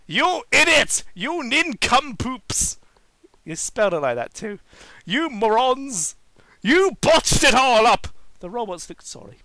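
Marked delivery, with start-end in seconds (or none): 0.1-2.8 loud, high furious insults; yelling villain. Acted. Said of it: "pacing and yelling with a red face". 5.0-8.1 loud, high furious insults; yelling villain. Acted. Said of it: "pacing and yelling".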